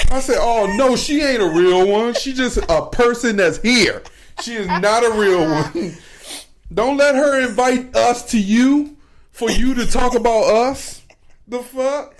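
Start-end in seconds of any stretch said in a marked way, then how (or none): 0.4-11.0 higher, louder, slower insistence; worked-up protest. Retold or imitated. Arguing with the audience about the heckler, high worked-up. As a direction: insist hard she's no real one. Retorts retold with heat.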